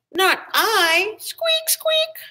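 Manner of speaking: very high squeaky rat. A narrator's reading. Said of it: "And the rat said".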